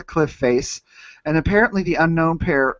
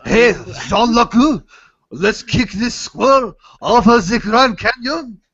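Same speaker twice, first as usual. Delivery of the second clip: high, loud cartoon French accent; 5 semitones higher, 4 dB louder than usual. Thug egging on Jean Luc to kick a squirrel, mock French accent. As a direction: exclaim it in a hammed-up French accent. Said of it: "That's my French accent".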